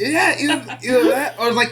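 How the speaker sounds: high laughing agreement; laughing along. Real, not acted.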